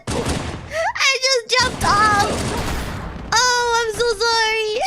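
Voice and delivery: high, drawn-out squeal of amusement; helpless laughter. Real, not acted. Gamer who just jumped off by accident, helpless laughter. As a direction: squeal it while cracking up. Cracking up after jumping off.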